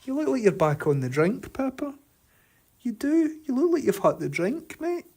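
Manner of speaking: high teasing dog voice. Real, not acted.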